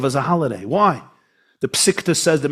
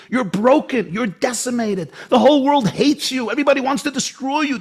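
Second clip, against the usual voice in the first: higher, taunting voice of the nations; mocking the people with a raised, jeering voice; mocking hostile world; 7 semitones higher than usual. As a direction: sneer it as the enemies would. Voicing the hostile world's message.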